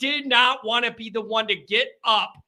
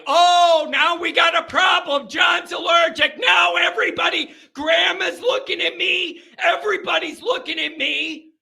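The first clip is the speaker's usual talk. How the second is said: mock girlfriend announcing; 6 semitones higher than usual. Amanda telling the whole family John is allergic, mock dramatic.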